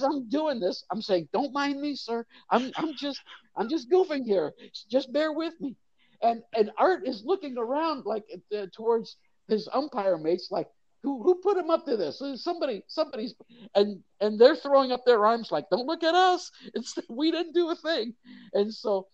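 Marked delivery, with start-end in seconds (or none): 1.3-5.8 lightly voiced, higher, sheepish "don't mind me"; half-whispered joke to the umpire, a bit higher; cheeky reassurance to the umpire while clowning; mock polite aside. Mock apology while faking argument. 11.0-13.3 higher, baffled mock umpire voice; imitating a confused umpire, much higher; puzzled umpire. Umpire asking his mates. 15.7-18.1 imitating umpire mates protesting innocence; defensive umpires.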